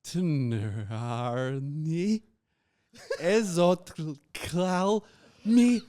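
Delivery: high, slow, drawn-out gibberish; backwards speech. Shown as a demonstration. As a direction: stretch out reversed syllables.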